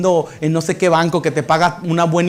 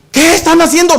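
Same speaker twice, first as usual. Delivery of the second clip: startled beggar; 9 semitones higher, 6 dB louder than usual. Acted. Beggar finds his house changed.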